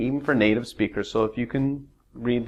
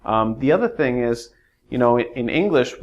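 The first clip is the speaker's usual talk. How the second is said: ordinary delivery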